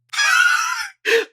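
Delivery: very high, long squeal of laughter. Real, not acted. Remembering the classroom incident, squealing laugh. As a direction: break into a shrieky high laugh.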